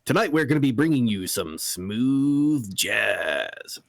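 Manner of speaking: low smooth late-night radio host; deep radio voice. Shown as a demonstration. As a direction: croon it like smooth jazz radio. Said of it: "lean into it".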